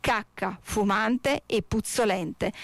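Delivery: usual speaking voice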